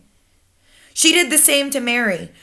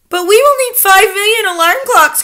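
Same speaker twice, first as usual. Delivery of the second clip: high, loud protest; worried crying out; 11 semitones higher, 8 dB louder than usual. A narrator's reading. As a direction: protest in alarm. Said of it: "cried the audience".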